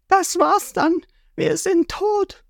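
high, slow, hopeless cartoon voice; deathly pale girl. Nami kneeling at the bow, mock despair. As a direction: give up dramatically. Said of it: "Nami kniet leichenblass".

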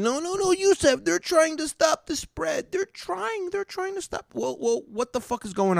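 very high mock-naive voice; protesting listener. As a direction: plead their case in a tiny high voice. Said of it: "And you're thinking".

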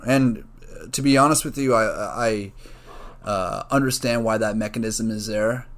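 ordinary talk

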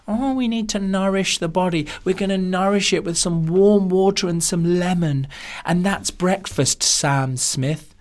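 a hint of a dreamy wellness guru; hippy nutritionist. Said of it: "hippy dippy types going".

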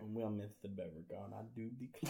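soft Southern belle accent; genteel Georgia accent, hushed at the end. Shown as a demonstration. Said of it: "It's that Georgia accent".

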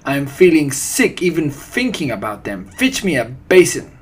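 loud, nauseated witch rant; revolted villainess outburst; screamed. A narrator's reading. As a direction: build to a high, demanding order. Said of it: "screamed the Grand High Witch".

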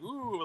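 very soft, half-hearted imitated whoop; unimpressed woo. Imitating him watching the win, unimpressed woo. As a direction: give a tiny, underwhelmed cheer. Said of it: "Like, he's unimpressed".

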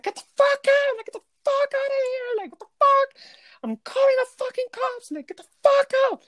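re-enacted scream, very high-pitched; retold screaming. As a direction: scream it as you did to the guest. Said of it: "I'm screaming at this bitch".

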